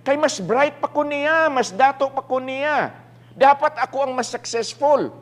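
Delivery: high-pitched, indignant boasting; proud protest. Acted. Proud man comparing himself to Brother Adrian, high indignant protest. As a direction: insist on it with wounded pride. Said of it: "in my pride".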